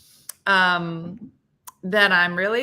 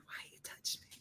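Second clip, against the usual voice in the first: hushed whisper, voicing the pet; pet's whispered complaint; 22 dB quieter, faster than usual. Voicing the pet who hates being touched, whispered complaint. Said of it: "She's like".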